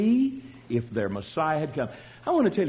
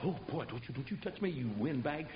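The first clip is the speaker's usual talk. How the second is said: hushed snub; 4 semitones lower, 14 dB quieter than usual. Retold or imitated. Peter snubbing the woman.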